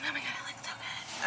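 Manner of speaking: breathy hushed whisper over food. Real, not acted. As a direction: whisper it, almost to yourself. Food arrives, hushed delight.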